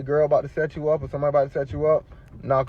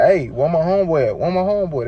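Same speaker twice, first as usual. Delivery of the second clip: high, louder retold demand; insistent questioning; 5 semitones higher, 5 dB louder than usual. Patient asking hospital staff about his friend, insistent questioning. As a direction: press them for an answer.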